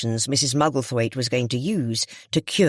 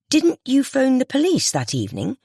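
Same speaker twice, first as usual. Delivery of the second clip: high, pointed question; accusing surprise; 10 semitones higher than usual. A narrator's reading. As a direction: catch her in the lie. Confronting with contradiction.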